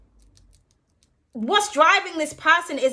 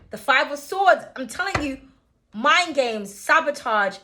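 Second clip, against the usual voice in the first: ordinary talk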